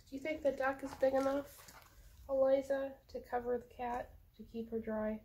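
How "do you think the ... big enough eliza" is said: plain reading voice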